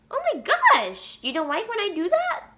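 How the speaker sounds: very high cooing squeal; squeaky-high doting voice. Real, not acted. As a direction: coo teasingly way up high. Breaks off to coo at babies.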